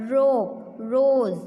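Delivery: "rope, रोज" as a drawn-out "ro", then a loud "rose"; teacher sounding out "रोज" slowly and firmly; first sound stressed. Shown as a demonstration. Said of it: "किसका sound सुनाई देता है".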